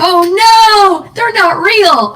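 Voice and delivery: high, loud, drawn-out mock dismay; mock shock. Player removes fake fangs for the viewers, mock shock. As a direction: play up the horrified discovery.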